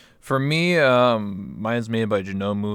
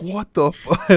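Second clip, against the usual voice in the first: higher, louder mock-shocked cry cut off; stunned outburst; 9 semitones higher, 8 dB louder, slower than usual. Friend in a chair reacting to a lap dance, mock-shocked loud cry. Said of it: "he's just like".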